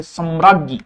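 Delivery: ordinary talk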